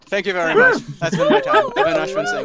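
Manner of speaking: very high, loud, laughing thanks; high laughing goodbye; excited sign-off. Real, not acted. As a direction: ride the crowd's applause out. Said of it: "closing his set".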